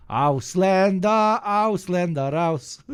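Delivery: high, loud German chant, slowly stretched; hammed-up slogan shout in German; mock German shouting. Streamer overdoing his best German accent, mock-shouting high and drawn out. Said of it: "give us your best German accent".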